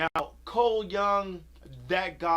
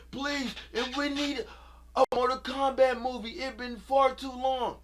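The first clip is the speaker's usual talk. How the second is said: pleading fans; 7 semitones higher than usual. Retold or imitated. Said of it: "the cries, and the echoes I was hearing".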